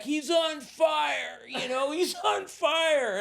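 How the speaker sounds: high, slow shout of alarm; hammed-up panicked shout; mock alarmed yell. Bystanders seeing smoke from his mouth, mock alarmed shout.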